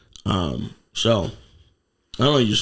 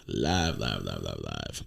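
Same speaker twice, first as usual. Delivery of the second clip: fading echo; 9 dB quieter, slower than usual. Pretend. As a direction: echo trailing away.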